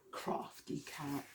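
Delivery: soft, slow unvoiced whisper; a sly whispered aside about the cat. A narrator's reading. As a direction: breathe it like a secret.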